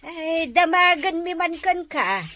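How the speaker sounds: ordinary talk, nothing marked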